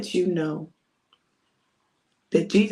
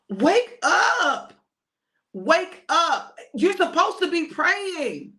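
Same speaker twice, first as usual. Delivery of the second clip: high, drawn-out rousing call; urgent wake-up call; 8 semitones higher, much slower than usual. Acted. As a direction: rouse sleepers who should be alert. Jesus waking sleeping disciples.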